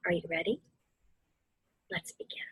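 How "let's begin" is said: slow, hushed meditation guidance; guided meditation whisper. Acted. Guiding the family through meditation, slow whisper. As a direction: breathe the words out gently, unhurried.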